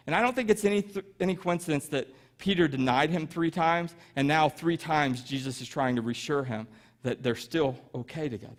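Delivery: ordinary talk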